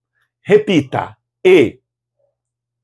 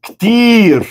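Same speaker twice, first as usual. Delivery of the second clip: KTIR exclaimed high on a long drawn vowel; exclamation; 8 semitones higher, 4 dB louder than usual. Shown as a demonstration. Teacher models a short answer for students, exclaimed. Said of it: "e com exclamação".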